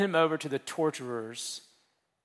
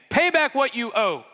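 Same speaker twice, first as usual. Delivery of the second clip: sharp demand, pitched up; 7 semitones higher, 4 dB louder than usual. Acted. Slave choking his fellow slave, demanding payment. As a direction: bark the demand at the debtor.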